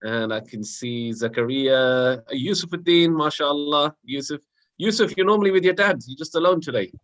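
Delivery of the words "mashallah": ordinary delivery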